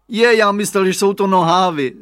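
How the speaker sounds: put-on surprised kid explaining his mistake; child's voice. A narrator's reading. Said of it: "řekl Radovan".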